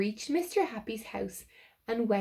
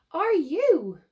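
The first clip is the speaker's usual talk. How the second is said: spluttering; 8 semitones higher, 8 dB louder than usual. Acted. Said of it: "managed to splutter".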